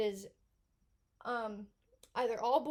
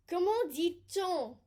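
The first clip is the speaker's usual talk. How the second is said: Comment dit-on? in a French accent, slow; 5 semitones higher, 4 dB louder, slower than usual. Shown as a demonstration. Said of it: "you'll see phrases like".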